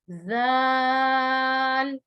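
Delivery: जाल held five counts; जाल drawn out long; long zaal. Shown as a demonstration. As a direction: stretch जाल out slowly, five fingers. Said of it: "five fingers तक".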